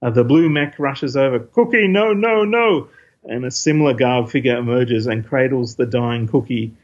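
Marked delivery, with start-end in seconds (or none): 1.4-2.9 anguished cry. Acted. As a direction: cry out in horror. Mech cries over dying Cookie.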